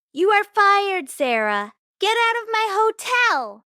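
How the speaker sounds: high, loud furious shout; furious firing. A narrator's reading. Manager firing the maid in the lobby, furious shout. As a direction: throw her out of the hotel.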